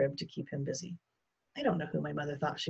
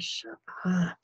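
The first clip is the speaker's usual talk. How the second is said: unvoiced hushing whisper. A narrator's reading. Boy hushes his babbling brother at night, whispering. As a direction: whisper it across the dark room. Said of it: "I whispered".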